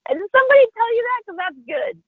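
high-pitched valet impression; imitated amused valet. Valet to her about her Cookie Monster look, amused imitation. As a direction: pitch it way up, tickled by the joke.

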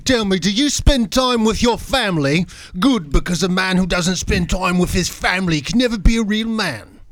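Godfather speech in British gangster dialect; British mob accent. Acted. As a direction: play the don as a London mobster. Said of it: "let's try the British mob dialect".

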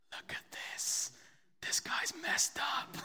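hushed whisper, mock secretive; imitated whisper. Preacher retelling the assistant whispering to the optometrist, hushed whisper. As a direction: whisper it like gossip behind someone's back.

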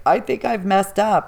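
ordinary delivery

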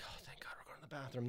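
quick whispered aside to yourself; whispered relief. Retold or imitated. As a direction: whisper it fast under your breath.

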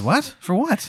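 high, squeaky bad Maryland accent; puzzled wizard in a terrible Maryland accent; the Wizard of Baltimore, baffled, in bad Maryland twang. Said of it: "terrible Maryland accent".

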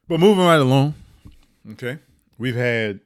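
ordinary talk, nothing marked

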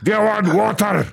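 high, barked mock-Scottish offer; aggressive Scottish accent. Train attendant offering water down the aisle, aggressive mock Scottish accent. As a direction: bark it as a scary Scottish vendor. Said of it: "that's how they talk over there".

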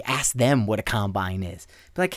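normal talking voice

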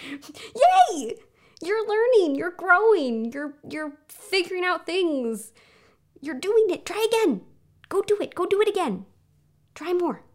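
loud, high-pitched cheerleading; champion voice. Acted. Inner champion urging a learner to keep trying, loud excited cheering. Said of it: "Doesn't that sound like more fun?".

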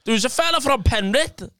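high-pitched storytelling mum; mum's voice. Retold or imitated. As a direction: mimic mum telling her news. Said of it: "She went".

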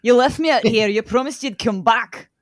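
put-on monster line in a Scottish accent; jokey Scottish accent. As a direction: growl it out in a put-on Scottish burr. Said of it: "It seems very Scottish".

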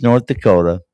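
normal talking voice

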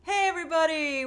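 ordinary talk, nothing marked

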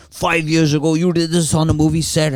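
high-pitched mock accusing voice; mock accuser. Friend at dinner voiced as a high, accusing scold. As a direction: put on a self-righteous accuser's voice. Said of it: "he thinks that he's like".

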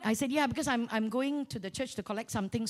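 ordinary talk, nothing marked

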